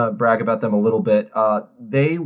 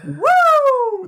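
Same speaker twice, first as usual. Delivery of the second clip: delighted whoop; 3 dB louder than usual. Real, not acted. Delighted reaction to bad accent.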